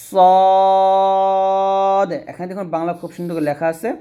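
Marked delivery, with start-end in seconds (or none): 0.1-2.1 সদ said full and heavy, louder; thick emphatic সদ; heavy Arabic sad. Shown as a demonstration. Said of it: "বলতে হবে". (মোটা করে সদ)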